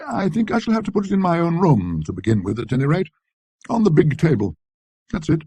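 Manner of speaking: lightly voiced anxious gentleman; fussy Dillett. A narrator's reading. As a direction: fuss over the delicate job to the butler. Dillett instructs the butler.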